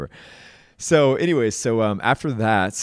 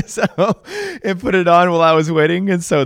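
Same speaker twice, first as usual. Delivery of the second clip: laughing; 11 semitones higher, 8 dB louder than usual. Real, not acted. Still laughing after hoodie laugh.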